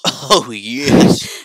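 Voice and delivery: loud triumphant burst; delighted loud celebration; excited cheer. Real, not acted. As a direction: cheer it out loudly. Celebrating the name being free.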